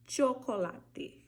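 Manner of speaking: chocolate said slowly, CH as in che; drawn-out chocolate with a crisp CH; Spanish ch sound. Shown as a demonstration. Teacher demonstrating ch in chocolate. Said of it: "o nome da letra já é o som dela".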